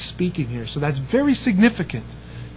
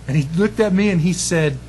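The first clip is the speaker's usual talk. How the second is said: usual speaking voice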